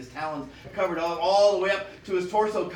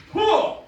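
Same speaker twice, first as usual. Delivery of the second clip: sharp huff; 14 semitones higher, 9 dB louder than usual. Acted. Eagle demonstrates scare.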